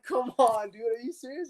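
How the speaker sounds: high disbelieving protest; can't believe what you're hearing; incredulous. Retold or imitated. Player to teammate over the seat trick, high and incredulous.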